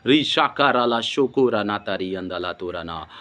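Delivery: low, murmured chant of syllables; praying in tongues. Real, not acted. Preacher breaking off his sermon into low tongues.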